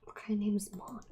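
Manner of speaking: whispered aside. Real, not acted. Listener whispering a snarky aside about the name. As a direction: breathe it as a sneaky mean joke. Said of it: "Sorry, that was really mean".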